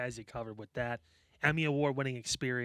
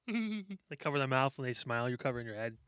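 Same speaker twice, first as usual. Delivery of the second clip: ordinary talk, nothing marked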